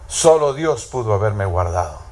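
low, hushed words of thanks spoken inward. Retold or imitated. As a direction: murmur it as a private realization. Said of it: "ella pensó".

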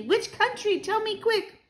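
put-on very high, urgent child's pleading; impatient cry. A narrator's reading. Grandchild begging for the country, impatient cry. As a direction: press for the answer in a high pipe. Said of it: "I cried impatiently".

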